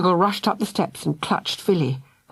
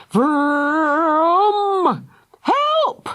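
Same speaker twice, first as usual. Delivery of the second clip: put-on high panicked child scream; screaming for help; 10 semitones higher, 3 dB louder than usual. A narrator's reading. Philly screams as lava chases them. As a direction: scream it over the lava's roar. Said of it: "screamed Philly".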